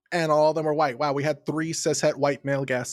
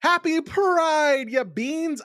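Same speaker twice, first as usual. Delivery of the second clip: festive greeting; 11 semitones higher, much slower than usual. Acted.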